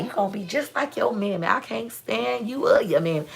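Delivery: lightly voiced cranky grandma scolding; great grandma scolding the little girl, mildly grumpy. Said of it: "She was the old grumpy lady".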